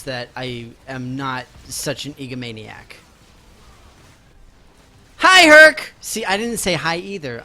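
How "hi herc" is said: higher, louder bright hello; greeting a chatter with a happy shout; excited greeting. Real, not acted. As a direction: call out the hello.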